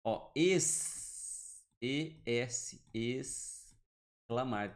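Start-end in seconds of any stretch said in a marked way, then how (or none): 0.3-1.6 "ex-" as a long hissed "essss"; sounded S. Shown as a demonstration. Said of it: "Tem um som de S". 2.9-4.7 "exclamar" slowly, each part stretched; sounded S. Shown as a demonstration. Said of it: "Tem um som de S".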